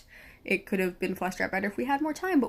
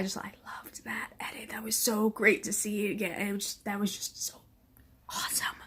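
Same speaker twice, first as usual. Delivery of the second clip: hushed, awed whisper; whispered admiration; 5 dB quieter than usual. Real, not acted. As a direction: breathe it out in awe.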